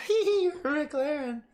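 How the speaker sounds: high, slow, mocking girl's echo; imitating her. Shown as a demonstration. Imitating the woman echoing his words, high slow mocking voice. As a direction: copy her teasing singsong. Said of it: "I said it just like how she said it".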